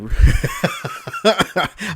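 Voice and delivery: loud, high laugh; real, helpless high-pitched laughing; burst of laughter. Real, not acted. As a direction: crack up at your own voice slip. Laughing at own voice crack.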